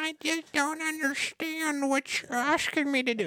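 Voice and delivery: put-on old lady voice. Prankster mimics an old lady to waste a scammer's time, high confused old lady voice. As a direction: play a befuddled old lady stringing the scammer along. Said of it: "so he can sound like an old lady".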